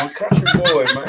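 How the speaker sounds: proudly claims country roots through a laugh; laughing proud boast. Real, not acted. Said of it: "throw that country boy tank twang on".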